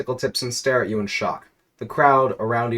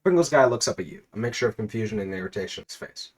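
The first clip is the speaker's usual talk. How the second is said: ordinary talk, nothing marked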